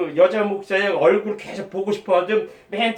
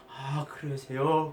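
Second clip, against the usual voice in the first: much softer and slow; soft slow reply; 7 semitones lower, 11 dB quieter, much slower than usual. Retold or imitated. The pastor answering him with gentle surprise, soft and slow.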